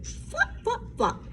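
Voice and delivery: high breathy flops; sound effect. A narrator's reading. Narrator voicing Froggy flopping, sound effect.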